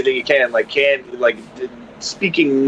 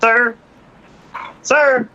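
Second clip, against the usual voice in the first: high, overly careful officer; polite officer voice; 8 semitones higher than usual. Retold or imitated. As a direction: ask it like a cautious cop. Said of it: "they're just like".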